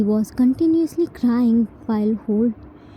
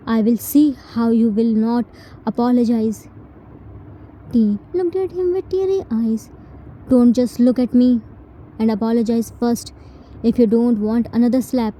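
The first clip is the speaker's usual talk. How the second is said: angry threat; faster than usual. Acted. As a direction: bark it as a warning. Jin threatens after slapping T.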